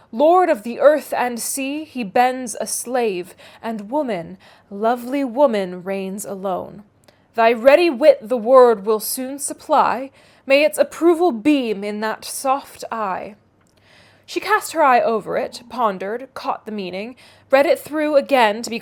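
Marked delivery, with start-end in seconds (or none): none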